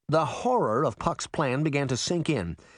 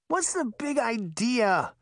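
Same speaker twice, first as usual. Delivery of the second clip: high, drawn-out groan of complaint; 9 semitones higher, slower than usual. A narrator's reading. Sleepy sister objects to being crashed into, groaning. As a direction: groan it slowly in a high child's voice. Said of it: "Daphne groaned".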